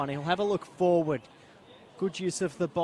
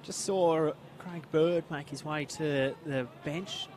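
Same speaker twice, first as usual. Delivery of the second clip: ordinary talk, nothing marked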